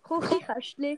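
says Küchenschrank the Swiss German way, as Chuchichäschtli; Küchenschrank turned into Swiss German Chuchichäschtli. Shown as a demonstration. Asked by his co-host to say it in Swiss German. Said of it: "Auf Schweizerdeutsch".

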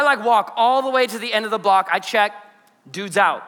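normal talking voice

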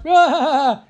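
loud, high wailing laugh; mock crying with laughter. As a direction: imitate someone laughing till they cry. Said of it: "crying with laughter".

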